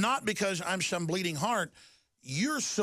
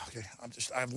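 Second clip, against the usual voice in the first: ordinary delivery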